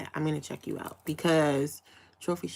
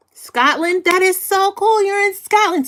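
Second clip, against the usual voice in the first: much higher and louder, delighted squeal; thrilled amazement; 13 semitones higher, 9 dB louder than usual. Real, not acted. Host learning a viewer is in Scotland, squealing with delight. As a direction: gush at the news like it's the best thing ever.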